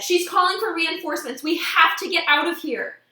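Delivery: high, loud urgent urging; urgent warning. A narrator's reading. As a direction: Annabeth hurries them out. Annabeth urging escape.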